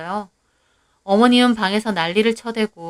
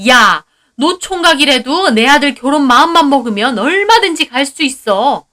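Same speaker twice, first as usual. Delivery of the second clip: loud, higher angry retort; furious mother-in-law; 4 semitones higher, 7 dB louder than usual. Acted. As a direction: shout back in defense. Mother-in-law shouting back. (분노한 시어머니의 반박)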